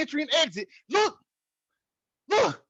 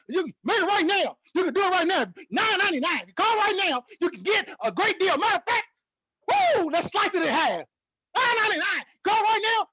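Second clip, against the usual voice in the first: mock infomercial; 5 semitones higher than usual. Jokes to viewers like an infomercial pitchman. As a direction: push the deal like a late-night ad host. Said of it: "a infomercial".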